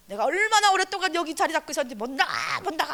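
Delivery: loud high desperate protest; frantic demon outburst. Retold or imitated. The demon refuses to leave the woman, loud frantic protest. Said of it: "막 발악을 하는 거예요".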